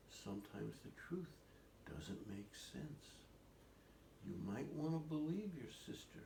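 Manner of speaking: lightly voiced wise old man, pausing mid-thought; thoughtful old man. Old man gently answering the puzzled children, thoughtful. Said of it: "he said".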